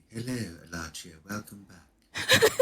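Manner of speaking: lightly voiced posh English lady, slow; mock posh voice. Shown as a demonstration. Teasing friend demonstrating a posh character voice, slowly. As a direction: drawl it like a mock-posh noblewoman. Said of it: "Speak like this".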